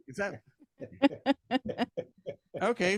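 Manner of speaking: ordinary talk, nothing marked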